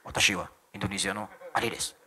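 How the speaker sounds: low mimicked Japanese voice; Japanese accent. Shown as a demonstration. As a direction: introduce yourself like a Japanese native. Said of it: "kayak orang Jepang".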